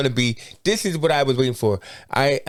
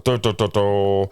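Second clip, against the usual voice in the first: dramatic dun dun. Retold or imitated. Dramatic music imitated.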